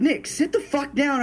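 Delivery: slow it down to settle him; exasperated order. Retold or imitated. Telling panicking friend to sit.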